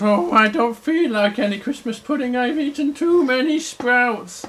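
a touch of overfull little bear. Acted. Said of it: "and Little Bear goes".

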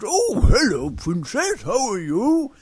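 loud, swooping storybook bear voice; big hearty greeting with swinging pitch. Acted. Big Bear meets the princess, loud swooping greeting. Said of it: "Big Bear goes".

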